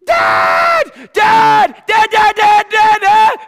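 high, loud frantic calls of dad; panicked child yelling. Retold or imitated. Boy hiding from a bat, screaming for his father. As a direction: shriek for dad over and over, terrified. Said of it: "And I'm like".

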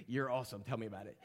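quiet starstruck praise; hushed fan gushing. Pretend. Imagined chat with Leo.

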